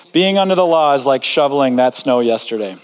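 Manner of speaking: usual speaking voice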